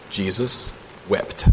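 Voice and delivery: slow and quiet, letting each word sink in; a soft, drawn-out solemn pause between the two words; solemn slow reading. Acted. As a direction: read it hushed and slow, with reverence. Solemn reading of shortest verse.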